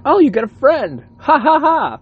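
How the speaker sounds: high, loud mock teasing; mock a teasing wife with a fake laugh; hammed-up high-pitched taunt and ha-ha; imitating his wife. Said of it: "My wife's like".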